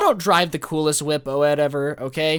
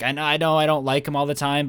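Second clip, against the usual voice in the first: usual speaking voice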